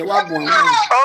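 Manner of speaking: high, slow wail breaking into tears; distraught slow cry. Real, not acted. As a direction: voice cracking into a sob.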